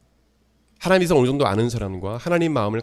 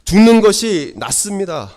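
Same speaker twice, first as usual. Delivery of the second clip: loud, high and slow protest as Jonah; acted angry plea, pitched high and loud; defiant outburst; 8 semitones higher, 9 dB louder, slower than usual. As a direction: cry out the complaint to God, drawn out. Acting out Jonah's angry prayer.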